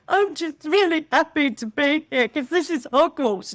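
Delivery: high, tearful-happy gush; gushing fan. Retold or imitated. Telling the park worker how happy she is, gushing. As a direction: pour it out like an overjoyed fan. Her answer to staff.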